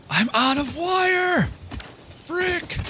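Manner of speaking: high, drawn-out yelp of frustration; high-pitched outcry. Real, not acted. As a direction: cry it out way up high, stretching the words. Exclaims on running out of wire.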